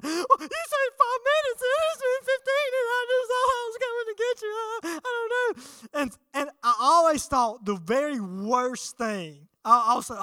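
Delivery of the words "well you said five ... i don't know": put-on high, far, sobbing little-boy voice; crying child. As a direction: plead through tears like a scolded kid. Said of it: "then I start bawling, crying".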